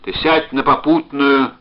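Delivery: loud, urgent begging; shouted plea. A narrator's reading. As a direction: plead hard down the phone, building to a cry. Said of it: "закричал он".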